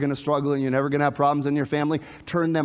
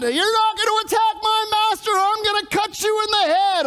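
very high, hammed-up threatening yell; mock-heroic shout, pitched way up; battle cry; 3 dB louder than usual. Preacher plays Peter defending his master with a sword, battle cry.

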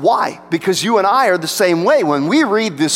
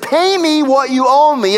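harsh demand; 6 semitones higher than usual. Retold or imitated. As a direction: squeeze the debt out of him. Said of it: "he began to choke him and he said".

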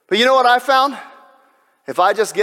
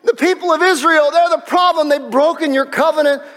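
high, distraught prophet pleading with God; high-pitched, hammed-up despairing rant; Elijah; 10 semitones higher, 3 dB louder than usual. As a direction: cry out in loud, desperate complaint. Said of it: "And Elijah says this. He says".

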